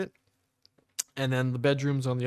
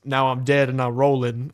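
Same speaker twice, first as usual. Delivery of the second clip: deadpan patient; 7 dB louder than usual. Retold or imitated. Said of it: "and he goes".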